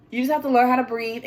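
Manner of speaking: ordinary talk, nothing marked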